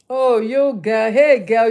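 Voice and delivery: loud, delighted call-out; excited greeting. Real, not acted. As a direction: greet her with a loud, happy shout. Greeting a friend's compliment.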